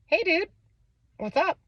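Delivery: light, very high, casual greeting; breezy casual. Retold or imitated. Mom greeting her hungover son, breezy casual. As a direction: play it cool, like nothing happened. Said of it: "I'm like".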